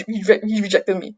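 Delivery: usual speaking voice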